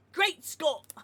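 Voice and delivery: a weak stab at Scottish; attempted Scottish accent. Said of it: "I can't really do Scottish".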